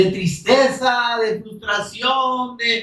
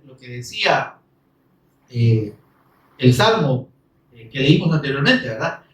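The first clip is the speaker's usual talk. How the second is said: usual speaking voice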